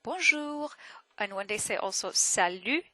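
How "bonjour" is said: "Bonjour" pitched high with a melodic lilt; high, tuneful "Bonjour"; French greeting intonation. Shown as a demonstration. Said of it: "they have this intonation when they say it".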